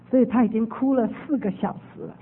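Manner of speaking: normal talking voice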